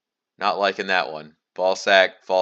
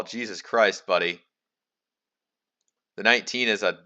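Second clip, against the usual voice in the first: usual speaking voice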